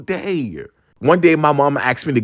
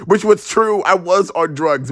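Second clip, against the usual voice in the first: normal talking voice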